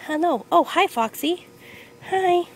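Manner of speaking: high, loud pet-talk; greeting a pet. Real, not acted. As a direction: squeal a happy hello to a dog.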